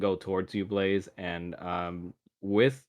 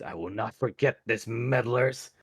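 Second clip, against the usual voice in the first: vengeful villain voice; 3 semitones higher than usual. Acted. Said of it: "He says".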